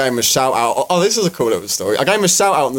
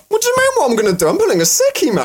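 high-pitched, indignant excitement; acted-out outburst to mates, pitched way up; excited defiance; 14 semitones higher, faster than usual. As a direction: blurt it out, giddy and defiant. Acting out defiant reply to mates.

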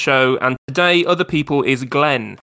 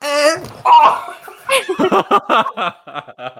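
bleating like a goat; a long shaky goat bleat; 13 semitones higher than usual. Retold or imitated. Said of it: "your impression of a goat".

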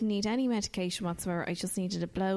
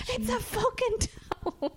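high, slow, exasperated squeal; slower than usual. Retold or imitated. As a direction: let the disbelief burst out slowly. Her exasperated reply.

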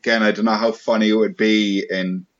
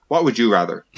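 ordinary talk, nothing marked

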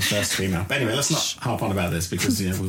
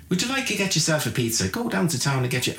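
lightly voiced Irish advert, higher; chirpy Irish ad pitch, higher; second Irish ad voice; 7 semitones higher than usual. Host playing the second Irish ad voice, higher. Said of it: "another voice would go in and go".

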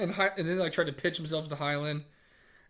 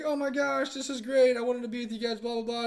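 mock excited gushing; 7 semitones higher than usual. Yuna greeting the team, retold.

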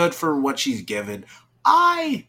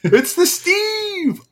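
excited; 3 dB louder, much slower than usual. Retold or imitated. Excited cheer at casting.

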